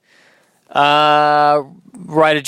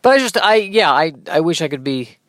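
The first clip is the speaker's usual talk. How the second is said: ordinary delivery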